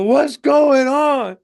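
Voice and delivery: high, slow, drawn-out mock shout; frightened passenger. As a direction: holler it from the back of the plane. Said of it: "picturing the guy on the plane go".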